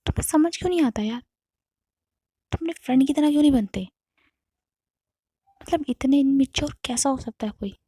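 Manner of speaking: wife losing her temper at her husband, angry outburst. Retold or imitated. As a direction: snap at him in frustration. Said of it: "एकदम से आरती को गुस्सा आ जाता है".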